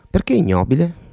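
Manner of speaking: Perché ignobile rising high in disbelief; a bemused challenge to the mother; puzzled question. Acted. Leo questions the mother's word.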